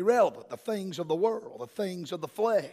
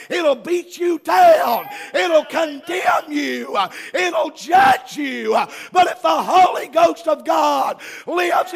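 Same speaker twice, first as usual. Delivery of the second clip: full-force preaching; 6 semitones higher, 10 dB louder than usual. Real, not acted. Preacher warning congregation what the book will do, shouted preaching. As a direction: hammer each phrase louder and higher into a sustained shout.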